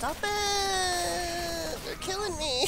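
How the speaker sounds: high desperate yelp of panic; panicked cry. Real, not acted. Gamer shrieking as monsters overwhelm him, panicked. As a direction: shriek as enemies swarm.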